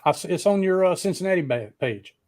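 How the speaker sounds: usual speaking voice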